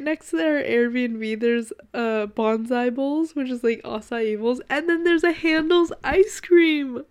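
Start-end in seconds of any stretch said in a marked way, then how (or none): 4.7-7.0 louder and higher, bursting with glee; thrilled. Real, not acted. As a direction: announce it like a treat. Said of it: "I'm so excited".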